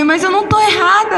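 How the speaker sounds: high, quick, defensive retort; a high, quick "I'm not wrong" protest at the angel; indignant protest. Retold or imitated. Retold protest against the angel.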